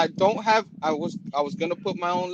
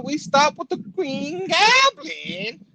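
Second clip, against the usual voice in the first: laughing; 12 semitones higher, 4 dB louder, much slower than usual. Real, not acted. Laughing at Goblin voice.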